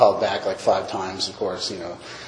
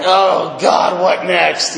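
loud, higher, drawn-out groaning complaint; mock exasperation; 5 semitones higher, 4 dB louder, much slower than usual. Drinker watching the world collapse, mock exasperated. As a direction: play up the despair for laughs.

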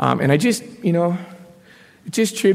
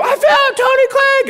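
much higher, louder, slow announcing yell; imitating a triumphant shout; 4 dB louder, slower than usual. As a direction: call it out to the whole room like a trophy. Girl announces him to room.

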